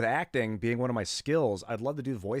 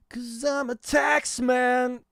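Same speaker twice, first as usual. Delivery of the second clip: slow, much higher put-on voice; mock tax man; 8 dB louder, much slower than usual. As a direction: ham up the tax man, drawn out. Joking tax man persona.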